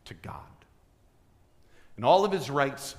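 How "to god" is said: breathy hushed "to God"; whisper. Real, not acted. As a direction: let the words fall to a whisper. Preacher drops to whisper for emphasis.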